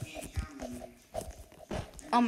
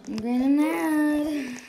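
slow, louder, drawn-out frustration; mock rage; 5 semitones higher, 7 dB louder, much slower than usual. Real, not acted. Gamer fed up with the tower, slow mock rage. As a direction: stretch it out in fake fury.

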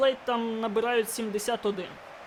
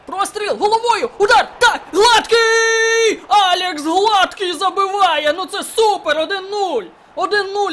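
a higher, louder goal shout, stretching out the scorer's name; roaring goal call, slowed on the name; goal celebration; 9 semitones higher, 12 dB louder, slower than usual. Real, not acted. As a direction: yell it like a commentator when your team scores. Scoring a goal in FIFA.

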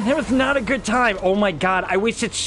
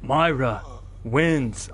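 low, measured announcer voice; Mortal Kombat; 8 semitones lower, much slower than usual. Acted. Gamer announcing who won the boss fight, announcer imitation. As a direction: declare the winner like a fighting-game announcer. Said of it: "Mortal Kombat fatality".

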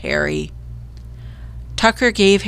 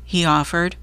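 ordinary delivery, nothing marked

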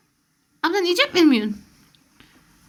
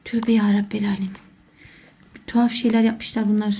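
whisper; 8 semitones lower than usual. Real, not acted. Scared muttering in dark game.

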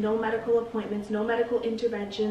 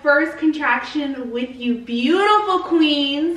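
excited; 5 semitones higher, 8 dB louder than usual. Real, not acted. Hyped vlog intro to viewers.

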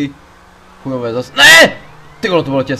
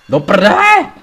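loud, higher swear at the screen; frustrated curse; 6 semitones higher, 8 dB louder, much slower than usual. Real, not acted. Gamer after failing the mission, loud angry curse. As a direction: let the fail sting out loud.

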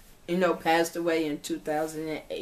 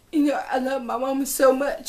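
real tears breaking through, higher and louder; crying; 7 semitones higher, 4 dB louder than usual. Real, not acted. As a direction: cry through the words about her mother. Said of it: "sorry for crying".

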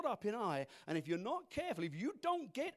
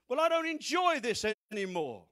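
raised, higher fed-up protest; acted loud complaint; 5 semitones higher, 11 dB louder than usual.

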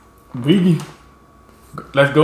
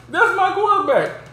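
much higher, excited imitation of T.O; T.O. impression; 12 semitones higher than usual. Recalling T.O.'s famous line, high excited imitation. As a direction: squeal it like T.O. on the sideline. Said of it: "Remember how T.O. was like".

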